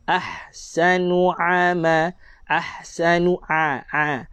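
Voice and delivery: very slow "ahsanu ama", the a squeezed from the throat as ain; correct ain. Shown as a demonstration. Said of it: "Nak baca dengan huruf ain".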